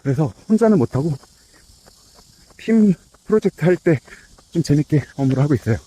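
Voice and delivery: talking mid-jog, a bit winded; while running. Real, not acted. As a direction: speak while running at an easy pace. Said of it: "이제 막 뛰기 시작했고".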